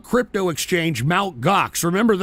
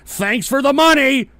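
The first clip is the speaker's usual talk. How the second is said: high, loud jeering thanks; mock gloating; 8 semitones higher, 5 dB louder than usual. Trader who cashed out to investors, loud mock gloating. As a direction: crow it triumphantly at the suckers.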